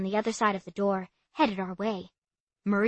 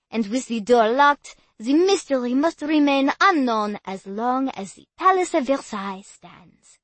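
put-on loud tour guide, French accent; French guide addressing tourists; 3 semitones higher, 6 dB louder than usual. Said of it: "heavy French accent".

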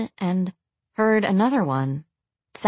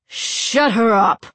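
loud, slow bellowed order; man's bellow; 4 semitones higher, 5 dB louder, much slower than usual. A narrator's reading. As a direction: bellow it slowly over the din. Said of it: "bellowed a man's voice".

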